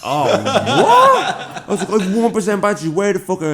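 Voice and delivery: high, loud laughing outrage; high laughing shout down the phone; laughing disbelief. Retold or imitated.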